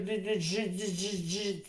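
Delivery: tipsy stuck on a syllable; stammering drunk; drunken stammer. Acted. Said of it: "non riesco a dire digerire".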